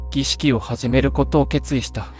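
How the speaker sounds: plain reading voice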